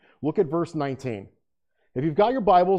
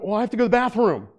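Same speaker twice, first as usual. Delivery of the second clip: quick, higher-pitched imitation of a child; child's excuse; 6 semitones higher, faster than usual. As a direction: blurt it like a kid dodging a chore. Child's excuse to dodge bed-making.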